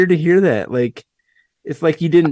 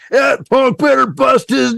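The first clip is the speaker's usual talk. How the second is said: aggressive shout; 8 semitones higher than usual. Retold or imitated. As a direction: bark it out like a fired-up caller. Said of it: "But now he's like".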